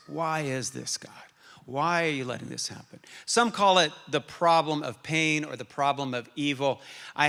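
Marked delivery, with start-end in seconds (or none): 0.1-3.0 whispered, soft question to God; hushed plea. Acted. Preacher voicing a hurting believer asking God why, whispered. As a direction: breathe the question like a private prayer. Repeating Habakkuk's complaint, hushed.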